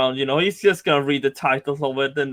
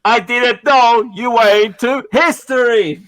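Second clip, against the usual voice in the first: echo through hands; 7 semitones higher, 6 dB louder, slower than usual. Shown as a demonstration. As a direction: voice cupped through hands, echoing. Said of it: "I just put my hands on my mouth".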